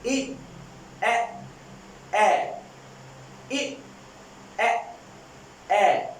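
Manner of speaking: short vowels said one by one; vowel drill. Shown as a demonstration. Teacher repeats the vowel sounds for students. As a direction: model each vowel with a pause. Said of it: "मैं again इन three sound को repeat करता हूं".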